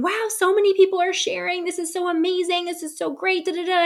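high, gushing imitation of a friend; excited girlfriend. Girlfriend gushing over all the sharing, high and excited. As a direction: mimic her bubbling over with praise, pitched well up. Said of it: "and she was reflecting".

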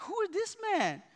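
high mock-startled wife voice; imitating his wife. Pastor imitating his wife reacting to his anger, high and startled. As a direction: pitch up and ask it like she can't recognise him. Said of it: "My wife's like".

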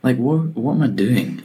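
high-pitched, mock bewildered questioning; incredulous self-questioning. Worker doubting the point of his job, acted incredulity. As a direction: question yourself in acted disbelief.

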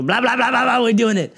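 loud, high, hammed-up excitement; going all out. Guest imitating Brett announcing the podcast, loud hammed-up excitement. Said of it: "he was going all out".

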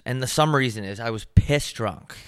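normal talking voice

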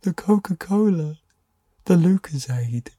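put-on high, thrilled kid voice; excited. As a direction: gasp it out like a kid in awe. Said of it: "I was like".

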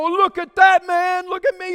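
high, loud mock show-off; mock bragging. Pastor parodying a selfie reaction, high loud mock brag. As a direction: ham up a self-centered brag.

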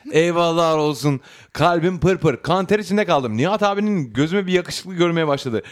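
reading a listener's letter, lively and animated; letter read aloud. Acted. Host reading a listener's letter to the audience. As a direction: tell her story like it's your own.

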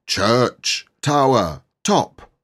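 drawn-out booming words; deep slow loud voice. Acted. Boy faking a grown voice from the tower. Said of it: "deepest voice, very slow and loud".

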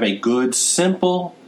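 "simple" set off and pitched high; stress on "simple". Real, not acted. Advising listeners on a tracking tool, stress on "simple". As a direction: underline "simple" with a clear lift in pitch. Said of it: "I underline the word simple".

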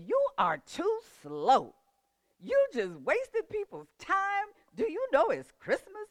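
high, loud mock bullying; imitating yelled scolding. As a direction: yell it like an impatient bully, drawing out the words. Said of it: "she started yelling".

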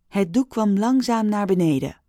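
usual speaking voice

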